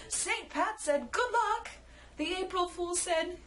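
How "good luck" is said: high, cheery good luck. Acted. Saint Pat wishing the group luck, cheerily.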